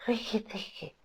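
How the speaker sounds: weak, slow fading creature voice; a hint of a dazed animal call before fainting; soft slow mongoose cry. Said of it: "de la cobra y dijo".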